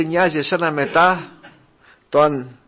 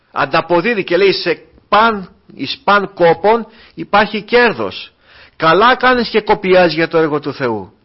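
raised, ringing preacher's exhortation; fervent preaching; 6 semitones higher, 5 dB louder than usual. Acted. Pastor urging his brothers to keep labouring, preaching at full force. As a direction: drive each "καλά κάνεις" home to the flock.

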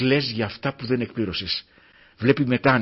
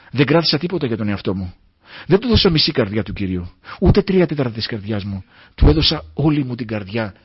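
louder, ringing preacher's testimony; fervent preaching; 6 dB louder than usual. Real, not acted. The preacher testifies to the church, louder and fervent. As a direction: proclaim it to the hall.

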